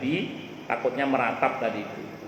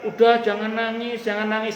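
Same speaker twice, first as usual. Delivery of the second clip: higher-pitched urging not to cry; mock consoling; 8 semitones higher than usual. Visitor telling a mourner to stop crying, high-pitched urging. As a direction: imitate a well-meaning visitor urging, pitched up. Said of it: "jangan berkata".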